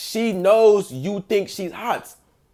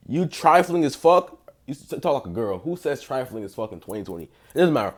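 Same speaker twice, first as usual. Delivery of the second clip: ordinary delivery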